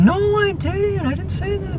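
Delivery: very high, whiny imitation of protest; drawn-out falsetto mock denial; protesting kid. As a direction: mimic a flustered boy denying it, squeaky. Voicing Brian's panicked denial.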